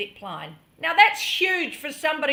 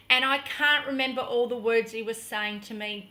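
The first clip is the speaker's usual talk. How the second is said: normal talking voice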